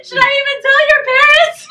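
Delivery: very high, loud imitated principal; hammed-up sympathy, squeaking upward; pitying principal. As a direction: voice a principal who feels terrible for the student. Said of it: "the principal felt so bad for me".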